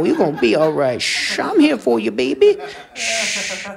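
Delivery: high, hushed cooing with shushes; smothering religion. Acted. Religion cradling a scared person, high hushed cooing. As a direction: coo and shush like soothing a baby. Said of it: "religion wraps itself around you like".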